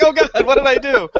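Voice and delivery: high, laughing panic; mock panic through laughter; mortified. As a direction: laugh out a mortified "what did I do". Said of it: "I'm like".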